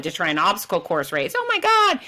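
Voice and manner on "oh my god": mock terrified. Scared newcomers facing obstacle race.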